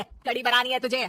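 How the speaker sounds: normal talking voice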